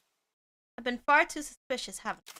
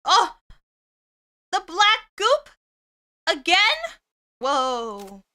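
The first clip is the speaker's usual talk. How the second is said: loud high shocked cry; startled; 5 semitones higher, 9 dB louder, slower than usual. Real, not acted. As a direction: jolt at the sudden sight.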